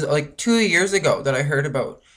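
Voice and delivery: ordinary talk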